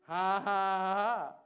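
loud deliberate ha-ha-ha; demonstrated laugh. Shown as a demonstration. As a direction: lead the room into laughing, loudly. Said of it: "need to start laughing right now".

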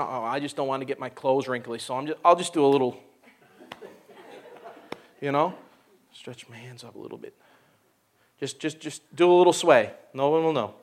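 mock reserved churchgoer; timid churchgoer. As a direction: hold back like someone afraid of wrinkles. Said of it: "we are all dressed up right nice".

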